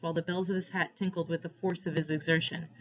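ordinary delivery

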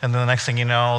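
ordinary talk

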